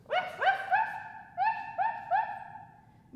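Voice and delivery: high, loud yappy woofs; dog barks. Pretend. Teacher barking in downward dog, high and loud. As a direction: bark like an excited puppy. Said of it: "find downward dog".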